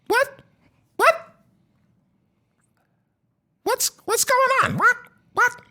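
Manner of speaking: put-on very high startled kid; a kid called over, confused. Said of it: "you hear a kid go".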